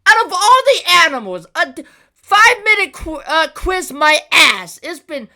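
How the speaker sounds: high, loud exasperated rant; irritated. Real, not acted. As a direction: rant in outraged disbelief. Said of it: "I can't tell you how irritated I am".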